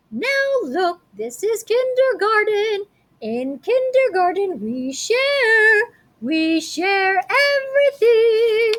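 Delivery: high, slow kindergarten teacher voice, drawn out; sing-song teacher. Acted. Teacher scolding the fighting kids, high and sing-song. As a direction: preach sharing like a cheery kindergarten teacher.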